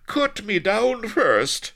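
high, loud, slow cry; desperate demand. A narrator's reading. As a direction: call out urgently to be cut down. Hanging Blood pleads to be freed.

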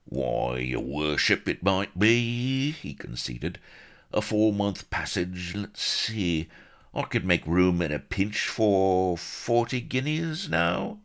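high, drawn-out, crafty skipper voice; captain haggling over a passenger's fare; wheedling sea captain. A narrator's reading. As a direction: bargain slyly for the fare. Captain bargaining.

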